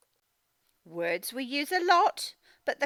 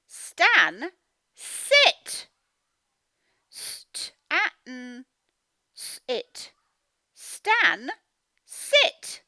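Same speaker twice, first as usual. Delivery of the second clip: high loud command Stan, sit; mum's command; 3 semitones higher, 7 dB louder, much slower than usual. Acted. Teacher voicing mum telling Stan to sit. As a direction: order the boy down like a cross mum. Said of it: "What's she saying?".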